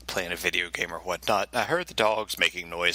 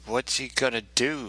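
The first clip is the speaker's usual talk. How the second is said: usual speaking voice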